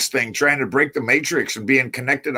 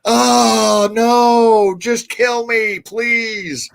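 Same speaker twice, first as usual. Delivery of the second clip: high, drawn-out hungover wail, loud then fading; hammed-up begging to be left alone; mock agonized plea; 8 semitones higher, 5 dB louder, much slower than usual. Hungover kid begging dad for mercy, mock wail.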